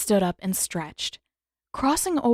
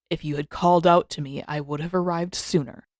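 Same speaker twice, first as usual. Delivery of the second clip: put-on lower, measured voice of an old master; a grumpy elder rebuking his student, lower; stern old Avatar Roku; 4 semitones lower than usual. Roku chides Aang for dawdling, in a lower stern voice. Roku's voice in story.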